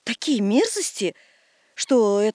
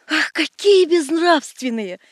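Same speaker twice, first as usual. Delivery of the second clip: scandalized; 5 semitones higher, 5 dB louder, slower than usual. Acted. Scandalized exclamation.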